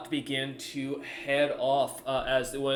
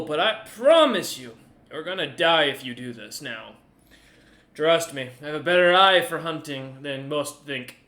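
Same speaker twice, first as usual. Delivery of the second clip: Goose the merchant; 2 semitones higher, 9 dB louder than usual. Acted. Merchant captain lecturing the party, loud and haughty. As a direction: lord it over them as the proud merchant.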